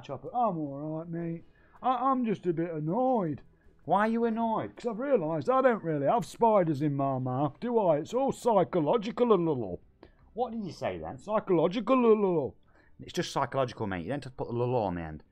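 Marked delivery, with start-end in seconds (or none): 0.3-9.8 much higher, louder put-on voice, a bit annoyed; high put-on voice, mildly put out; annoyed character voice. 11.2-12.6 much higher, louder put-on voice, a bit annoyed; high put-on voice, mildly put out; annoyed character voice. Same character repeats.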